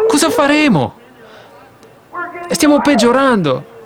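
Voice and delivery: a hint of an anguished Amram; troubled husband. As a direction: plead over the people's burden. Amram answering his wife.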